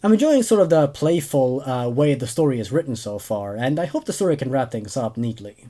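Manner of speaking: ordinary delivery, nothing marked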